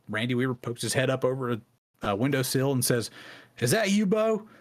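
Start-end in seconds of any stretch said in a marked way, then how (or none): 3.6-4.5 much higher, surprised voice of a man at a window; surprised call. Retold or imitated. Randy Weaver leans from the windowsill calling to Bo, surprised and higher. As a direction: call down, surprised to know him.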